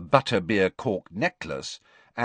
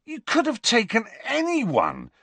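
high, incredulous Ron; can't believe his ears; disbelief. A narrator's reading. Ron to Harry over dinner, incredulous. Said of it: "said Ron in disbelief".

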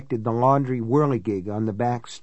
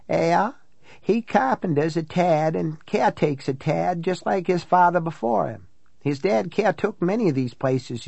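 higher-pitched country woman telling ghost lore; folksy, chatty housekeeper, voice pitched up; local housekeeper storyteller; 6 semitones higher than usual. Acted. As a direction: pass on Ma's tales like a local gossip. Housekeeper gossips about local drowning.

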